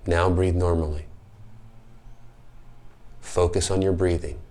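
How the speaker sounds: slow, soft meditation voice with long pauses; guided meditation. Acted. Host leads children through slow breathing, calm guided-meditation voice. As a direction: guide a breath calmly, letting each word settle.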